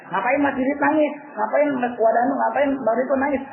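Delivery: high-pitched, lightly acted remonstrance; mock chiding. Acting dying man chiding weepers.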